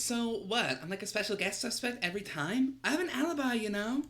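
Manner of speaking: femme voice. Acted. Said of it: "I'm doing a lot of fem voices".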